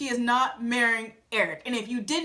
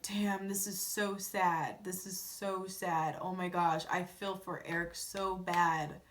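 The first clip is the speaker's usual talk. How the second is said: sad; 6 semitones lower, 9 dB quieter, slower than usual. Real, not acted. Reacting sadly to the rose ceremony.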